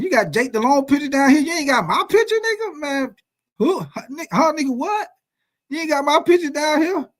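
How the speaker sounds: high-pitched indignant ranting at the team; mock outraged rant. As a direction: pretend fury at being left off the wall.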